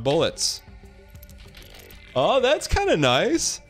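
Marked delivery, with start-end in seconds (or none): 2.2-3.6 high, slow delighted oh; pleasantly surprised. Real, not acted. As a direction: savor the surprise, pitch way up. Delighted reaction, big pitch jump.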